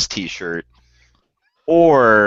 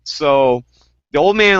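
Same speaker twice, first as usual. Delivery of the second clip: ordinary delivery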